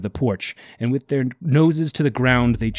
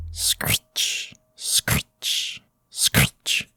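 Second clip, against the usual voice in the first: cat screech; much slower than usual. A narrator's reading. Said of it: "It sounded like a cat".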